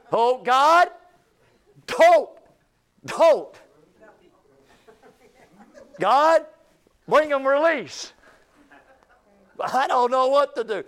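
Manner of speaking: loud, high hammed-up plea to God; desperate prayer. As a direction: cry out to God in mock desperation. Acted desperate prayer in the truck.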